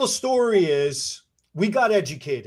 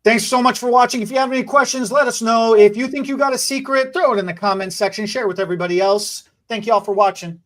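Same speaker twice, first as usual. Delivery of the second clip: vlog outro; 3 semitones higher, 5 dB louder, faster than usual. Real, not acted. Outro to viewers.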